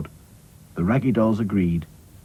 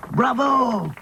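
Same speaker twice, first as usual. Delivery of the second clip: shouted; 13 semitones higher than usual. Acted. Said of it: "shouted Claude".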